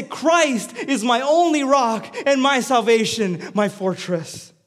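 loud, high, emphatic proclamation; full-force preaching. Real, not acted. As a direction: build to an impassioned declaration.

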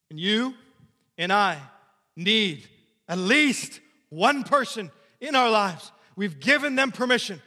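loud, high preacher's plea; full-force preaching. Acted. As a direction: press the congregation hard.

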